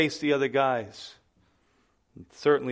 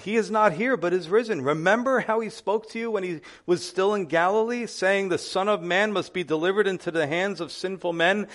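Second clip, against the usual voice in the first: higher, stirring read of the angel's words; scripture reading; 7 semitones higher, 5 dB louder than usual. Acted. Pastor reading Matthew to the congregation, proclaimed scripture. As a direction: proclaim the verses to the church.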